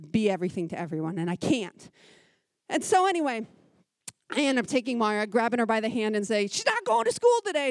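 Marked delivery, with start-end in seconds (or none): 6.5-7.7 high, loud, sharp declaration; curt and angry. Retold or imitated. Mom telling the bus driver her daughter is staying home, angry. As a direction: lay down the law through gritted teeth. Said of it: "I didn't say it very nicely either".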